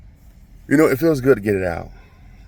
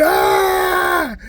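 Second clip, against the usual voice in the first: long, piercing high scream; mock scream; 6 dB louder than usual. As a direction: shriek it out like a kid getting beaten. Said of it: "I started screaming".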